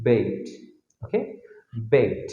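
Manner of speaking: বেট with a long gliding "ay", like bait; long A sound. Shown as a demonstration. Teacher repeating the changed word, বেট with long A. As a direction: say বেট low, the vowel drawn into "ay". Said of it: "ই বর্ণ যোগ করার কারণে এটা হয়ে যাবে".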